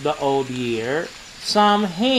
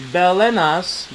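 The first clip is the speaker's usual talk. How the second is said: normal talking voice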